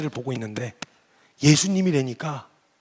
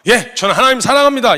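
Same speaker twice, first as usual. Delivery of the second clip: earnest declaration; 8 semitones higher, 11 dB louder than usual. Retold or imitated. Imagined confession to God.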